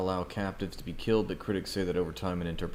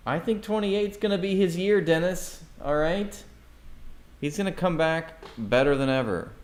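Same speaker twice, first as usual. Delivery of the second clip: high put-on voice, mocking; mock character voice; 8 semitones higher than usual. Streamer mocks a chat fan in a high put-on voice. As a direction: play a goofy booster, pitched way up.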